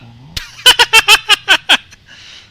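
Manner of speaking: high, loud burst of laughter. Acted. Loud laugh after line.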